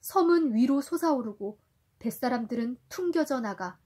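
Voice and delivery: gentle storybook reading for a little one; reading to a toddler. A narrator's reading. Parent reading Sindbad to a child. (아이에게 동화 읽어주듯)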